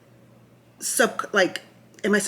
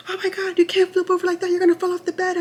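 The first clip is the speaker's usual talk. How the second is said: high-pitched, panicky mimicking of staff; alarmed warning; 12 semitones higher, 3 dB louder, faster than usual. Nurses warn a patient flipping over in bed, alarmed.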